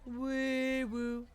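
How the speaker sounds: ordinary delivery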